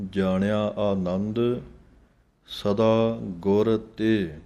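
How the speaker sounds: ਗੁਰ and ਤੇ spoken apart, slow; ਗੁਰ ਵੱਖਰਾ, ਤੇ ਵੱਖਰਾ; separate words. Shown as a demonstration. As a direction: split ਗੁਰ from ਤੇ clearly. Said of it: "ਇਹ ਪਾਠ ਅਲੱਗ-ਅਲੱਗ ਬੋਲਣਾ ਹੈ".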